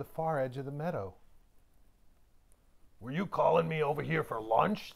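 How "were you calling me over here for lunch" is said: grinning wolf voice. A narrator's reading. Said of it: "the old wolf grinned".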